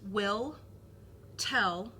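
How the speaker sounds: "will" and "tell" each stressed and set apart with a pause; stressed words only. Shown as a demonstration. Teacher isolating "will" and "tell" for the student, stressed and rising. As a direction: pick out "will" then "tell" with a rising lift. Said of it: "So if we take the stressed".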